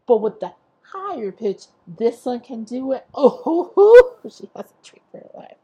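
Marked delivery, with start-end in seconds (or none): 3.1-4.2 mock high-note "oh" with a laugh; high loud "oh". Podcaster showing a singer's higher pitch, high laughing hoot.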